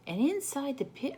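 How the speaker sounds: plain reading voice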